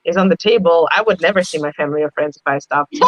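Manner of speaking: ordinary delivery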